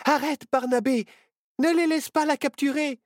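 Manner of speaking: high, urgent cry to stop; alarmed plea. A narrator's reading. Friends beg Barnabé to spare the whale, alarmed.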